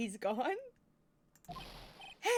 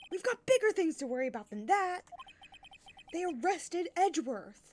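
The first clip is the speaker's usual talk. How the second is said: put-on higher, louder girlish Maya; perky sidekick voice; 8 semitones higher, 5 dB louder than usual. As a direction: voice Maya up high.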